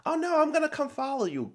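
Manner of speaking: high-pitched mimicking of an overeager follower; mock clingy friend. Commentator mimics Joellen refusing to give space, mocking high voice. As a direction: put on a squeaky voice, mocking her. Voicing friend who won't back off.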